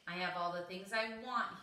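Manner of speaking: usual speaking voice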